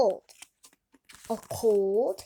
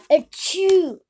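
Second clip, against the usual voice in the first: big high acted sneeze; loud sneeze; 5 semitones higher, 11 dB louder than usual. Gerald sneezing all over Piggy, loud fake sneeze. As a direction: let a huge sneeze burst out.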